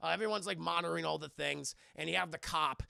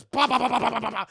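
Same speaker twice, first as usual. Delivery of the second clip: much louder rhythmic bah bah; imitated banging; 4 semitones higher, 12 dB louder, faster than usual. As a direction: bang out the noise with your voice. Imitating a loud banging noise.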